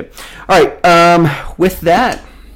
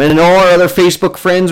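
normal talking voice

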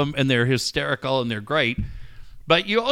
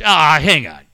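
usual speaking voice

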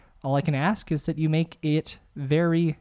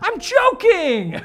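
high, drawn-out yell; protesting shout; 5 dB louder, much slower than usual. Real, not acted. Host defending a joke to a friend, protesting shout. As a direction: cry it out high and long. Protests after a teasing remark.

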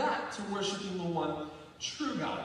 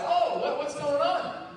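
mock-innocent taunt, higher and louder; raised, teasing mock concern; 6 semitones higher, 4 dB louder, slower than usual. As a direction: feign surprise to needle the rivals. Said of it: "He starts taunting them".

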